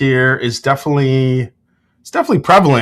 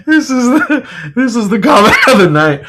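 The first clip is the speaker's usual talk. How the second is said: laughing; 9 semitones higher than usual. Real, not acted. Laughing at a funny chat comment.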